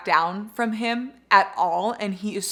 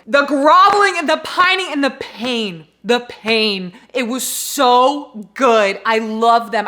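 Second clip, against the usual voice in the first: much louder and higher, dramatic anguished gush; swooning anguish; 4 semitones higher, 11 dB louder, slower than usual. Real, not acted. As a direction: wail it out like delicious agony. Gushing about the pain.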